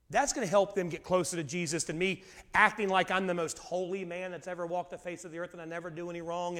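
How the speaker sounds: ordinary talk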